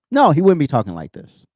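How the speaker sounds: usual speaking voice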